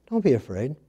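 soft, hushed reassurance; quiet calming angel words. Retold or imitated. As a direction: soothe the shepherds gently. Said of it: "And he said it very calmly".

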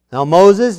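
ordinary delivery

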